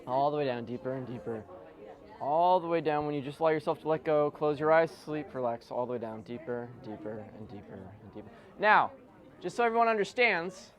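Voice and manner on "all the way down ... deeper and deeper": deep lowered drone sinking into deeper and deeper; hypnotic induction. Acted. Hypnotist sending volunteers under, low soft droning.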